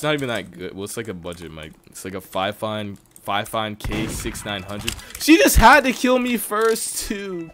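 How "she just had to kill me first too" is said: high, slow wail of grievance; loud complaint. Real, not acted. Streamer killed first in the game, loud complaint. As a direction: wail it loudly, stretching the words.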